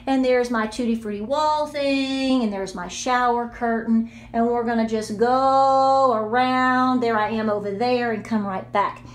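ordinary delivery

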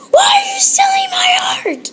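very high, loud hammed-up scream; shrieking mock outrage. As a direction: scream it like a cartoon.

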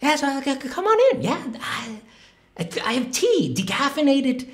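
timid math character. Pretend. Math, now a nervous character, invites the artist in for tea. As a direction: play shy math as a nervous little host.

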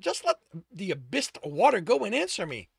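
angry snap. A narrator's reading. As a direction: press angrily for an answer. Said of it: "Sto snapped".

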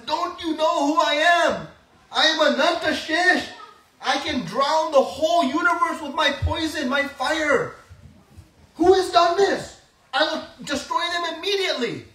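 high, loud furious boasting; angry elder brother. Acted. Balram sees Krishna tied and rages, high and loud. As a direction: threaten to destroy whoever did it. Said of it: "And he becomes angry".

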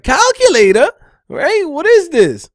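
high, loud barked soldier report; crisp loud soldier report; soldier boy voice. Retold or imitated. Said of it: "With a soldier voice, boy, soldier boy voice".

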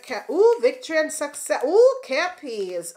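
high, loud, thrilled gasp over good news; light up at the card; excited ooh. Real, not acted.

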